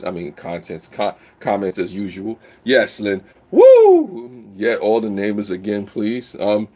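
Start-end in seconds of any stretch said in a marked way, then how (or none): 3.5-4.4 very high, loud whoop; loud woo. Real, not acted. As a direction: cheer it out hard. Cheering a viewer in chat.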